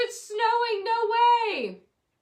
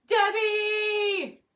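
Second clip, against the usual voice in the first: calling out; 9 semitones higher, 6 dB louder than usual. Real, not acted. Calling out to someone.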